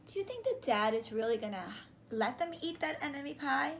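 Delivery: plain reading voice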